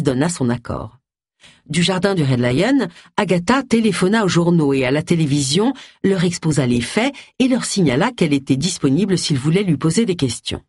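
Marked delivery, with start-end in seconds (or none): none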